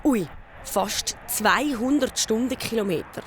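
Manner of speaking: ordinary delivery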